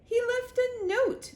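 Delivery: insistent queen. A narrator's reading. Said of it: "said the Queen".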